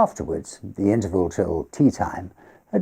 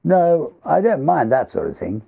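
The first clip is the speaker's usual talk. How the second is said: a lightly voiced host, pitched higher, calmly dismissive; Everard's voice; 10 semitones higher, 4 dB louder than usual. Host Everard brushing off ghost stories to guests, lightly higher and calm. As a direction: play the pale host shrugging off ghost talk.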